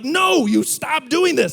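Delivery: high, slow scolding of a dog; mock-angry owner's telling-off; retold yelling. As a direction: scold the puppy, drawing it out. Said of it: "I yell at her".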